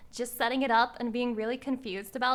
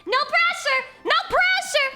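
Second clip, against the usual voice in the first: hyper boasting; 10 semitones higher, 6 dB louder than usual. Acted. Hyper bragging boast, much higher.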